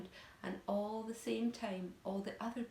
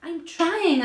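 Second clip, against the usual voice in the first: strained protest; 9 semitones higher, 15 dB louder than usual. A narrator's reading. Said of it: "answered the poor glowworm".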